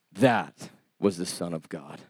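very low, hushed and slow; deep, soft, solemn pronouncement; awestruck Roman soldier. Acted. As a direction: let the realization sink in slowly. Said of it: "the Roman soldier looks at him and says".

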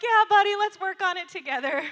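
high, loud, delighted reply; enthusiastic agreement. Retold or imitated. Mom answering her three-year-old's confession, loud and upbeat.

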